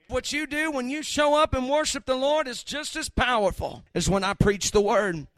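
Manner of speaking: usual speaking voice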